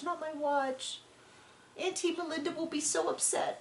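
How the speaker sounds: put-on a little boy wailing over his lost watch; imitating crying child. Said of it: "I think it might have been his watch".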